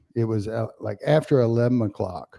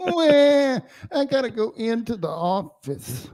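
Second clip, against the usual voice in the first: whiny; 11 semitones higher than usual. Retold or imitated. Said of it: "attitude of a girl I saw on Twitter".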